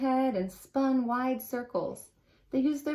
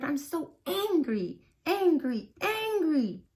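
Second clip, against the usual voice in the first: rising, each angry higher; building anger; 6 semitones higher than usual. Acted. On fumes alone on his bed, rising anger. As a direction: let the anger climb.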